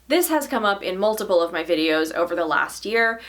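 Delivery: ordinary talk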